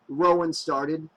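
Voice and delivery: ordinary delivery, nothing marked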